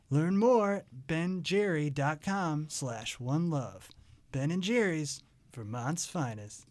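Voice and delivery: smooth, unhurried commercial voice; ad read. Acted. Host reading the Ben and Jerry's ad to listeners, smooth ad voice. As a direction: sell the ice cream like a radio spot.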